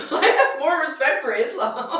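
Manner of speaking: laughing mock quote. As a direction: tease by quoting him. Mock-quoting Sean laughing.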